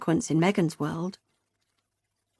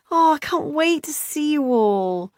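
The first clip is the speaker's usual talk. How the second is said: higher, slow longing sister voice; 8 semitones higher than usual. A narrator's reading. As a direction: sigh it out with longing. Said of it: "said Beth longingly".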